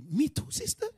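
high-pitched mock empathy; overemotional. Preacher mimics a listener sharing her pain, overemotional. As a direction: gush with exaggerated fellow feeling. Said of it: "you self come with the emotion".

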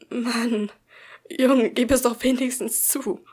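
loud, desperate plea. A narrator's reading. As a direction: push him, desperate for the truth. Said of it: "Die Verzweiflung in Tims Stimme".

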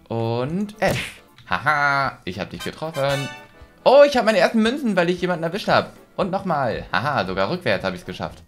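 ordinary delivery

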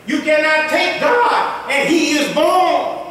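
loud, slow, high preaching. Real, not acted. Preacher raising his voice to the congregation, loud and slow. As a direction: thunder it out to the congregation. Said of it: "'cause I raise my voice".

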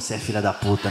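low, soft deadpan curse; quiet swearing. Acted. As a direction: drop low and softly curse your friend. Bino's muttered reaction to friend.

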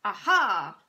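loud sudden Aha; cried out. A narrator's reading. Shopkeeper cries out to the room, struck by an idea, loud. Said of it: "cried out".

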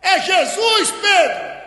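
loud, high cry to a companion; full-voiced, high-pitched yell; excited shout. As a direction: shout the discovery across the boat. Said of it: "olhou e gritou".

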